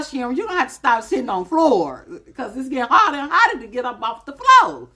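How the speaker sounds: mock self-scolding. Telling herself, Sharon, to quit sitting on the floor, mock scold. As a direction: talk yourself down like a nagging friend.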